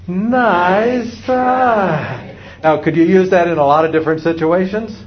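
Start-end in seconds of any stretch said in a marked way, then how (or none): 0.1-2.3 high, drawn-out singsong "nice try"; cheerfully unfooled parent, stretched out; big-grin comeback. Shown as a demonstration. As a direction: sing it through a big grin. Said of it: "Let's hear it".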